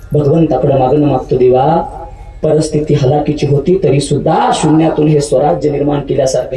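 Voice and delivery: low, earnest prayer to God. Acted. Prayer for the family. (देवाकडे खालच्या आवाजात कळकळीची प्रार्थना)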